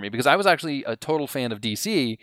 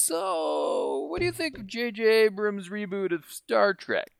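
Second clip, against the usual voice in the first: lightly voiced D C asking, higher pitch; a hint of D C putting the question; mock D C voice; 8 semitones higher, 5 dB quieter, slower than usual. Friend at dinner, imitating D C testing him lightly. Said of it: "nice accent".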